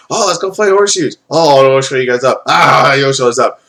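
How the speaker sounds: hammed-up sporty enthusiasm; mock chummy brothers. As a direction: imitate over-friendly guys egging each other on. Voices overly nice brothers.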